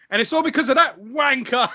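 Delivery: put-on high, loud outburst in Saint Lucian accent; hammed-up angry Saint Lucian coworker. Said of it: "I used to do his accent".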